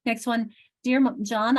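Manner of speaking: ordinary talk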